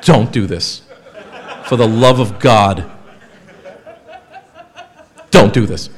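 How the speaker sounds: loud, pleading warning; loud exhortation with weighty pauses; emphatic plea. Real, not acted. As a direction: beg the audience loudly, leaning on each word. Emphatic warning repeated to the audience.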